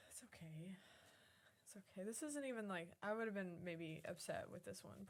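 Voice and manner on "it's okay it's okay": hushed, gentle reassurance; soothing whisper. Real, not acted. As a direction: whisper softly to calm the dog. Comforting the guilty dog.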